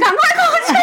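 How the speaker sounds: high shout breaking into laughter; imitating her brother's kitchen yell through laughs; laughing mock yell. Aunt retelling her brother's shout from the kitchen, laughing. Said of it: "在厨房大喊".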